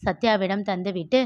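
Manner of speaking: ordinary delivery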